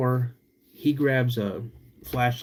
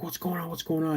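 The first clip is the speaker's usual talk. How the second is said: higher, softer worried voice; a friend running in, alarmed; lightly voiced worried buddy; buddy asking in alarm; 6 semitones higher, 6 dB quieter, faster than usual. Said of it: "He's like".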